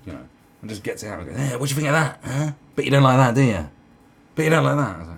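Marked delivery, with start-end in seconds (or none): 1.4-4.9 loud, insistent taunt; mock challenge, fishing for outrage; goading provocateur. Someone flaunting scars to shock onlookers, loud goading.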